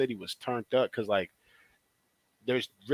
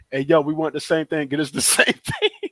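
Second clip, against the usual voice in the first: excited shout; 6 semitones higher, 6 dB louder than usual. Retold or imitated. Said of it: "And we was like".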